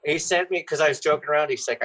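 normal talking voice

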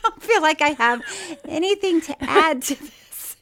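real laughter breaking into the admission; laughing confession. Real, not acted. Woman admits to co-host she adds nothing to chat, laughing.

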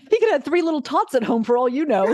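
laughing through the words. Real, not acted. Host teasing guest about the man, laughing. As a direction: chuckle as you tease.

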